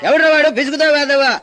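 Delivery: loud, high shout at the class; angry yell. Acted. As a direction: bark at whoever made noise. Teacher yells at noise. (కోపంగా గట్టిగా అరుపు)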